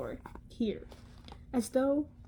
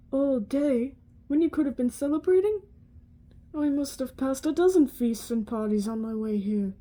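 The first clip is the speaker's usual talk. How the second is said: put-on a louder, indignant Scottish-accented professor; 6 dB louder than usual. Professor in a Scottish accent scolds him, indignant and loud. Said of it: "I might butcher this accent".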